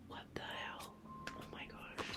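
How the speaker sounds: far-off startled whisper; hushed shock; whispered surprise. Real, not acted. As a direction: gasp it under your breath. Startled at card.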